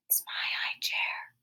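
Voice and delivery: slow hurt whisper. Acted. Peter whispering to his father about the chair. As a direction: breathe it out, hurt and possessive. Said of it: "whispered Peter".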